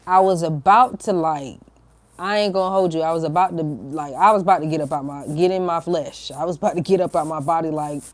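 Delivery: normal talking voice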